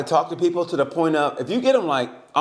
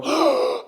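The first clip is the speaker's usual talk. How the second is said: sharp high gasp of shock; 13 semitones higher than usual. Retold or imitated. Voter spotting Baird on the ballot, sharp gasp. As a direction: gasp in surprise at the name. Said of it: "I literally went".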